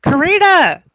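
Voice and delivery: loud, high call with a drawn-out stop. Acted. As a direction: shout to stop someone. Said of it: "he's like".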